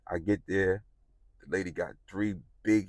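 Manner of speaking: ordinary talk, nothing marked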